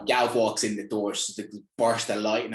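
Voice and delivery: ordinary delivery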